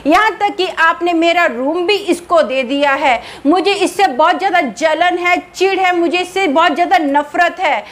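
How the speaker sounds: high, eerie, bitter ghost voice; angry ghost. Acted. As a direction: pour out hurt and hatred as a spirit. Ghost rants at family, jealous. (ऊँची, कड़वी, नाराज़ भूतनी की आवाज़)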